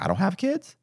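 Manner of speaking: disbelieving jump up in pitch; incredulous retort. Retold or imitated. To the friend who turned him in, incredulous. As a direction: scoff at her excuse.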